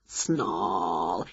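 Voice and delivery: very low, hushed growl on the word; snarling word. A narrator's reading. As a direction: stretch it into a soft low snarl. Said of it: "came a noise from the woods".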